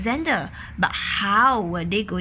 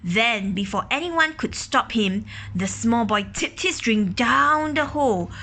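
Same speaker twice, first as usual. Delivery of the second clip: ordinary delivery, nothing marked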